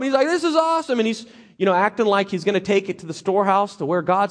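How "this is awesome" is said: a thief hamming up his praise; gleeful Achan. Retold or imitated. Achan gushing praise to the people, high and gleeful. Achan gloating over loot.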